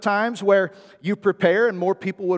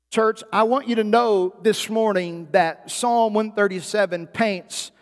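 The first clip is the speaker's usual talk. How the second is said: usual speaking voice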